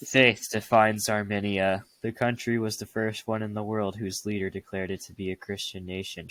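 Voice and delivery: reading aloud. Shown as a demonstration. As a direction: read it like dictation. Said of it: "narration and dictating voice".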